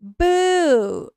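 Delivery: sudden loud high boo; startling boo. Pretend. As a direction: jump out and shout boo. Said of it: "Did I scare you?".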